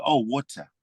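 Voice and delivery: "water" as "wutta", South African-style; South African accent. Shown as a demonstration. American expat re-ordering water for South African waiters, South African accent. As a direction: say "water" the South African way, short rounded first vowel. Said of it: "So you have to".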